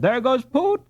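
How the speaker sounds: Van Moonen cries out in alarm as Jacob falls; cried out. Acted. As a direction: call out as a boy falls. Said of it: "cried Van Moonen".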